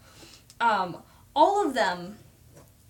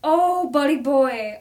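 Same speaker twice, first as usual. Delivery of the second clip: sympathy; 3 semitones higher, 8 dB louder, slower than usual. Real, not acted. Sympathy for a sobbing viewer.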